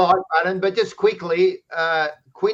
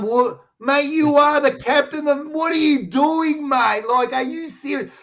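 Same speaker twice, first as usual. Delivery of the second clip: much higher, disbelieving outburst; incredulous challenge; 10 semitones higher than usual. Retold or imitated. Telling off the team captain over his kid, high and incredulous. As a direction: challenge a mate in high-pitched, stunned disbelief. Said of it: "I'm going".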